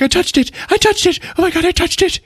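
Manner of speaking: very high, hammed-up panic; freaking out. Kim in the bathtub after touching the heart, high mock panic. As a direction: imitate a frantic, inconsolable woman. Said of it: "she's, like, freaking out".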